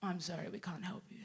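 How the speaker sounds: very soft, low apology; imitating a timid, apologetic boss; sheepish boss. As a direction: murmur it as an awkward refusal. Boss's confused reply.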